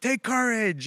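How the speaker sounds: high, drawn-out cheer; imitating students calling out in solidarity; rallying cry. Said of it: "they would just put their fist".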